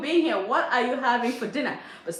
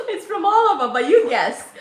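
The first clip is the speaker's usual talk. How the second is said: loud, talking through laughter; laughing tease; 7 semitones higher, 9 dB louder than usual. Real, not acted. Host teasing a viewer to guess her accent, loud and laughing. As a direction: tease them while still laughing, voice raised.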